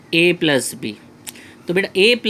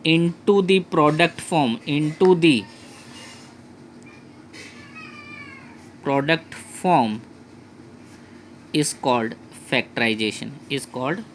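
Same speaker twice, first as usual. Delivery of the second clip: dictating slowly; 3 dB quieter, much slower than usual. Shown as a demonstration. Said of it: "dictating the definition to students".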